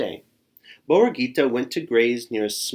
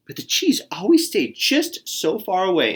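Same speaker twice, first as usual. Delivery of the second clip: usual speaking voice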